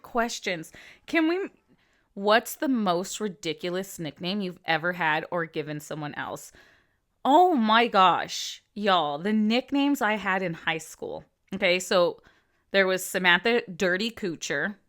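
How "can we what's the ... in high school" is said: usual speaking voice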